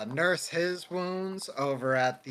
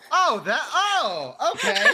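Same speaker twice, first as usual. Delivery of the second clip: relieved laugh; 12 semitones higher, 9 dB louder than usual. Acted.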